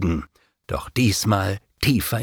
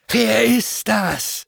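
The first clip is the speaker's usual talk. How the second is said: much higher, slow demonic query; demon hissing; 11 semitones higher, slower than usual. A narrator's reading. Fallen angels demanding who he is, high and slow. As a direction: raise it high, slow and sneering.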